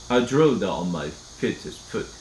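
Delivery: usual speaking voice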